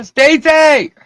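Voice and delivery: high, loud shouted greeting; shrieked hello to a friend; playful scream. Real, not acted. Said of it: "I love screaming now".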